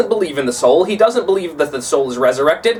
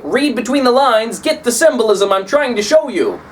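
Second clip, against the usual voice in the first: eager, slightly higher paraphrase; paraphrased author; 6 semitones higher than usual. Retold or imitated. Lecturer paraphrasing Dante to students, mildly eager. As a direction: spell it out for the reader, a touch higher. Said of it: "he's saying".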